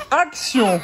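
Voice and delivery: mock film director shout; director call. Parent directing baby's crying, mock. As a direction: call action like a set. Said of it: "je vais redire action".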